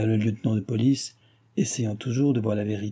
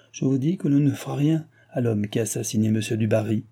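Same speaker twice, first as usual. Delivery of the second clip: plain reading voice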